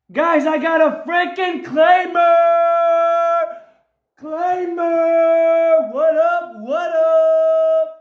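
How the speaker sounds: triumphant hollering. Real, not acted. Winner boasts to viewers about a claimer, triumphant hollering. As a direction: crow it out, last syllables sustained.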